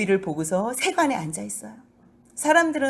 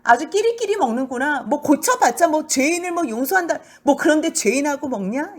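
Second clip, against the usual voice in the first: scornful taunt; 5 semitones higher, 4 dB louder than usual. Acted. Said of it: "이제 이렇게 얘기를 하는 거지".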